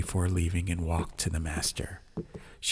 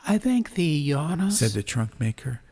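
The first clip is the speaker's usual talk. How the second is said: put-on 1 humble old craftsman; 9 semitones higher than usual. A narrator's reading. Trunk maker voiced in story.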